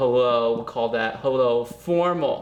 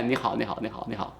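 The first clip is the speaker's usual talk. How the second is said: quick repetition; 4 semitones lower, 5 dB quieter, faster than usual. Shown as a demonstration. Quick casual repeats of phrase.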